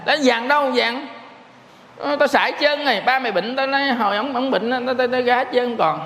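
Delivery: put-on old mother tells her gambling son the gold is gone; mother refusing. Mother refusing son's request.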